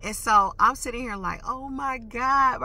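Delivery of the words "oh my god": drawn-out, louder exasperation; talking to herself. Retold or imitated. Driver talking to herself after the fact, retold slow exasperation. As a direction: stretch it out, pitched up. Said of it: "I'm talking to myself after the fact".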